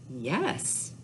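normal talking voice

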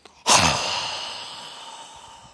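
forceful, long sighing exhale; energetic cleansing sigh; big release sigh. Shown as a demonstration. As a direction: whoosh all the air out. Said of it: "fill that belly up with air again".

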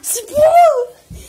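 slow, loud, high shout. Real, not acted. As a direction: yell it out, drawn out. Fighting over the cards.

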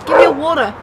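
put-on New York accent, having another try. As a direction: say it like a New Yorker. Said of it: "I got it".

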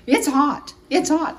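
high, loud yelp; yelp at the heat. Real, not acted. Burning her finger on the pan, a high yelp.

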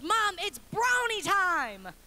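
put-on slow, high, loud toddler announcement; excited toddler. Toddler to mom first thing in the morning, excited announcement. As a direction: proclaim it like an overjoyed three-year-old.

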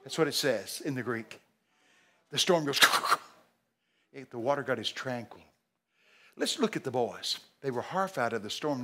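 ordinary delivery

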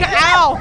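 loud, high imitated "ow!"; mock screaming. Retelling everyone on the ride, mock screamed "ow". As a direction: yelp it out like a screaming rider. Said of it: "We're all just screaming".

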